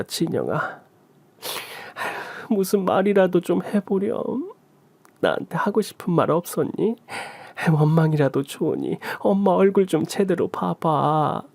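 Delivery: mother asking her daughter to look at her; lightly voiced mother. Lightly voiced mother, gently pleading.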